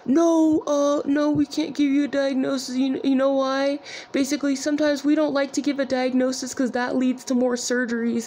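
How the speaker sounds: put-on high voice for a retold refusal; mocked urologist. Urologist refusing the patient a diagnosis, mocking high voice. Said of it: "And then he says".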